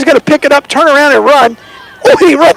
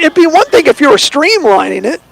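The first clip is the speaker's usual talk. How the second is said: ordinary talk, nothing marked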